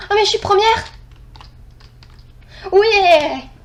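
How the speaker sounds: real delight at coming first; thrilled cheer. Real, not acted. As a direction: cheer at the win. Realises she won the match.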